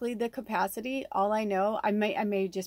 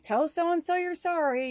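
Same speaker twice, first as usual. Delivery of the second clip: high mock parent ordering; bossy parent telling kids off; a hint of a scolding parent; parent's order; 10 semitones higher, 3 dB louder than usual. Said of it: "your parents are like".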